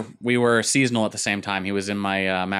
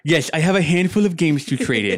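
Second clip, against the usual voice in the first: raised, geeky nerd voice; higher-pitched mock nerd; 6 semitones higher, 4 dB louder than usual. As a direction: play a nerd opening a trade-in negotiation. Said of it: "the nerd voice".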